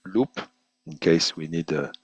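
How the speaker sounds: ordinary talk, nothing marked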